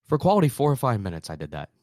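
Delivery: ordinary talk, nothing marked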